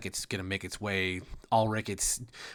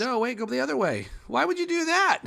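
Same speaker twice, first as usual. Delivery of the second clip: high-pitched cry of disbelief; exasperated protest; 13 semitones higher, 3 dB louder than usual. Real, not acted. Game master protesting a move the wrong way, exasperated. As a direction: protest it, voice shooting way up.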